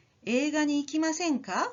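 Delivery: 映画に行きませんか with the pitch rising at the end; rising invitation. Shown as a demonstration. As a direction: lift the final か into an inviting question. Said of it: "with an upward intonation would be".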